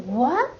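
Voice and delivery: WHAT rising sharply like a surprised question. Shown as a demonstration. Teacher likens second tone to English, surprised rising question. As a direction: slide WHAT upward in disbelief. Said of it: "Just like".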